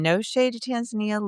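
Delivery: normal talking voice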